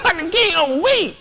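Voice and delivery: high, weak-kneed swoon through a laugh; hammed-up starstruck church lady. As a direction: melt at his name, laughing. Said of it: "You're like".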